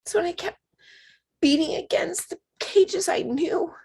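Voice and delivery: emotional. Real, not acted. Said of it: "I'm getting emotional".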